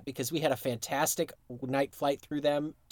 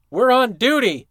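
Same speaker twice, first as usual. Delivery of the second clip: high, loud reenacted yell; full-force shout; screamed; 8 semitones higher, 10 dB louder than usual. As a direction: bellow it like a scene being acted out. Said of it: "he just screamed at me".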